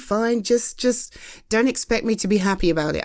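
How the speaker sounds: normal talking voice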